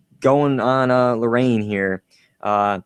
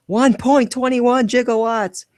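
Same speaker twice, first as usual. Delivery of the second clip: much higher, strained Doc voice; high, frantic mock Doc; mock Doc exclamation; 12 semitones higher, slower than usual. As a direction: squeak out the number like a frantic scientist. Quoting Doc's famous line.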